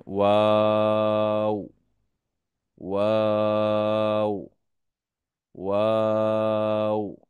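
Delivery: ওয়াও drawn out long and slow with rounded lips; teacher sounding out ওয়াও very slowly; slow letter demo. Shown as a demonstration. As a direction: stretch ওয়াও slowly for students to repeat. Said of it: "আমার সাথে সাথে বলুন".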